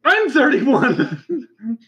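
high, slow laughing disbelief. Real, not acted.

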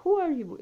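"Who" high and falling; stressed question word. Shown as a demonstration. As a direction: open strongly on "Who". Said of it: "Se você me pergunta".

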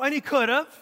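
ordinary talk

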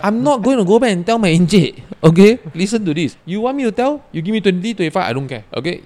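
loud, high-pitched telling-off; retold heated refusal. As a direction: re-enact putting your foot down, pitched well up. Retold row over passing a message.

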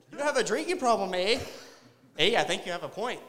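mock two chatty Canadians, eh; cartoonish Canadian guys trading lines; Canadian accent. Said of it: "two Canadian guys coming up with that".

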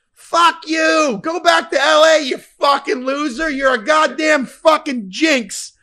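high, loud hammed-up crowd abuse; angry heckling. Angry home fans blaming the visiting jinx, yelling abuse. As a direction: yell it across the stands.

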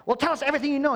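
fast, high, loud cop grilling; interrogator. Retold or imitated. Pharisees interrogating the healed man, hammed-up cop. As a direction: grill him like a detective under a lamp. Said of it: "they're interrogating him".

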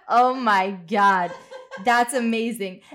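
loud, drawn-out laughing reaction; amused amazement. Retold or imitated. Retelling own reaction to the film's funny lines, amused amazement. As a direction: relive being delighted, laughing as you stretch it.

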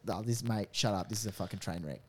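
muttered aside. Retold or imitated. His own muttered aside retold.

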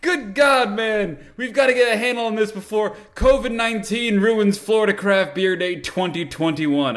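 high, urgent scientist impression; high alarm, stretching out toward the end; Doc. Time traveler warning that covid ruins beer day, urgent high voice.

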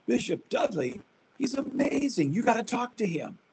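high, eager praise of the bishop; thrilled, rising enthusiasm; excited bishop. Retold or imitated. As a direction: gush about him like the bishop did.

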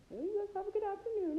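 very high, loud imitation of a polite goodbye; mock polite farewell. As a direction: send them off in a high-pitched mock voice. Retold buddy's hasty polite exit.

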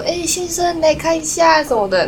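mock vendor hailing a customer; imitating a street vendor. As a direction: wave a passer-by over to the stall. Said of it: "就说".

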